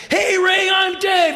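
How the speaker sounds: high, loud hollered greeting. Retold or imitated. Rock singer introducing himself across the gallery, high shouted greeting. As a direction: holler hello like a big showman.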